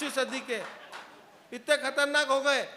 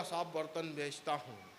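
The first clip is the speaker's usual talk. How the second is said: low, soft, timid answer; meek reply; 9 semitones lower, 11 dB quieter than usual. Acted. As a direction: mumble it sheepishly. Groom's humble reply in joke.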